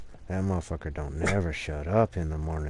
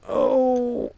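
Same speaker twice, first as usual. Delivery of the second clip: pained cry; 7 dB louder than usual. Real, not acted. Groan while stretching sore arm.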